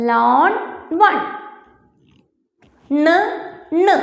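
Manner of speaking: ലോൺ and വൺ, then the final ണ് sound alone; demonstrated rhyming endings. Shown as a demonstration. Teacher showing pupils how both words end, demonstrated N ending. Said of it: "അങ്ങനെയല്ലേ നിർത്തിയേക്കുന്നത്".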